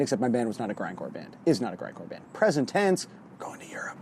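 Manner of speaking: hushed whisper to spare neighbours; library whisper. Real, not acted. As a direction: whisper it under your breath. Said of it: "I'm whispering".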